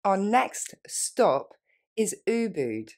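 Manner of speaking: our next stop drawn out slowly. Shown as a demonstration. Teacher modelling for students, very slow. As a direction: stretch it for the learner. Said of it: "Plus lentement".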